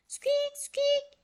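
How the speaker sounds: tiny high squeaky mouse voice; shrill mouse squeaks. A narrator's reading. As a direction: squeak like an angry little mouse. Pet mouse squeaking at monkey.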